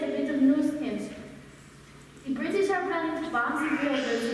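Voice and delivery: ordinary delivery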